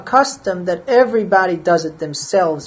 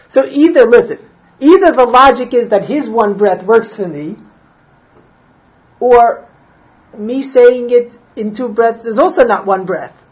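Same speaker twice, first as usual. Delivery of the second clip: emphatic argument; 5 semitones higher, 8 dB louder than usual. Real, not acted. Heated point of Talmudic logic.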